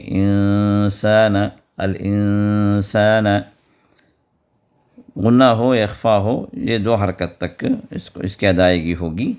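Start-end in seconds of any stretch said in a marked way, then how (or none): 0.0-3.3 الانسان with ن hidden into a nasal hum before س; انسان, ن nasalised not fully said; hidden nasal nun. Shown as a demonstration. Teacher demonstrating ikhfa in الانسان. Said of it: "ناک سے چھپا کر ادا کریں گے".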